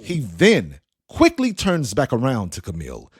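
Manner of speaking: ordinary delivery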